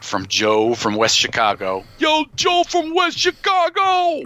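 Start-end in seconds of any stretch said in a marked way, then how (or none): 2.0-4.3 very high, drawn-out holler; stretched, high-pitched playful yell; hyped-up shout-out. Pretend. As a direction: call out to a listener like a hype man. Mock shout-out to a listener.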